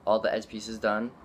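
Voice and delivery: ordinary talk, nothing marked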